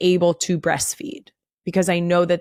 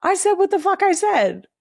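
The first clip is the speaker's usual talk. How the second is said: defiant; 12 semitones higher than usual. Real, not acted. Defiant quoting own line.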